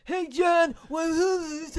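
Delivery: high, loud mock shout; imitating old-timers. Cohost shows how those people sound, hammed-up shouting voice. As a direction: holler it like an exaggerated old-timer caricature. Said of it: "they sound like this".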